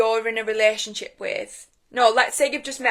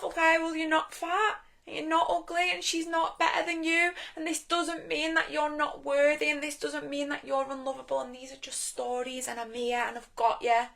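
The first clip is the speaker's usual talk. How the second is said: higher, tender reassurance; loving self-talk; 5 semitones higher, 3 dB quieter than usual. Acted. Loving self comforts hurt inner child, higher and tender. You start loving yourself.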